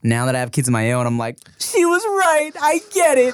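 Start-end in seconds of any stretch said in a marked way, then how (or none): 1.6-3.3 high, strained mock wail; exaggerated realization. Parent now gets what mom meant, high overdone wail. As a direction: play an overwrought new parent.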